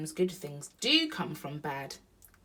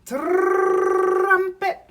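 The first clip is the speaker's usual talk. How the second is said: very high, loud trilled blast; elephant trumpeting; 15 dB louder than usual. A narrator's reading. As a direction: roll the word into a trumpet call. Elephant blows new trunk.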